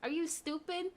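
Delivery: high mocking "are you stupid"; indignant retold retort. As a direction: scoff at his stupidity. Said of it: "I said".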